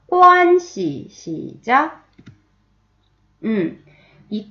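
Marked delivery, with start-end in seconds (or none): 0.1-1.1 관 씨 with 관 high, loud and level, 씨 lighter; high first tone. Shown as a demonstration. Teacher drilling tones, 관 씨 high and level. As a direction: hold 관 high, then release 씨. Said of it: "한 번 더".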